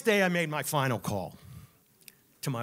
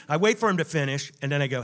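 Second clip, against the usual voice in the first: ordinary talk, nothing marked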